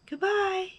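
small faraway high voice; echo. A narrator's reading. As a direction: answer like a distant echo. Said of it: "his own voice echoed".

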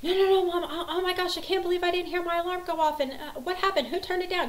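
high, frantic child imitation; rushed high-pitched mock meltdown; freaking out. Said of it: "She starts freaking out".

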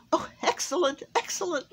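high, loud, jolly exclamation; laughing delight. A narrator's reading. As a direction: chortle it out with delight. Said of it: "laughed Santa".

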